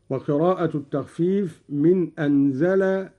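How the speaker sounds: ordinary delivery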